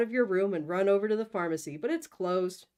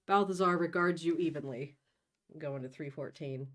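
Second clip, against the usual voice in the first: ordinary delivery, nothing marked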